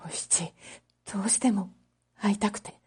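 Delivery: a lightly voiced shy young man; soft young man. Kichisaburo telling Oshichi he longed to see her, quietly. Kichisaburo confesses, half-frozen.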